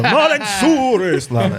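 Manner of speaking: mock proclamation. Teasing a friend as if in the town square, grand proclaiming. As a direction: proclaim it like a national hero. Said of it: "standing in the town hall square going".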